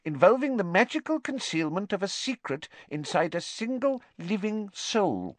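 high, squeaky professor; squeaky little lecturer. A narrator's reading. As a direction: explain in a tiny piping voice. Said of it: "he said squeakily".

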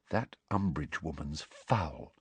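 quiet and low, confiding. A narrator's reading. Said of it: "she said in a low voice".

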